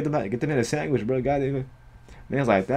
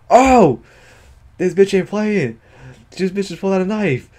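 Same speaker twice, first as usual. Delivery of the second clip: shocked; 7 semitones higher, 4 dB louder than usual. Real, not acted. Shocked reaction to knife.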